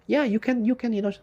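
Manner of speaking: very high-pitched mock pep talk; mimicking people's empty cheerleading; mocking naive encouragers. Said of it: "If people say".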